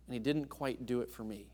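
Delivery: ordinary delivery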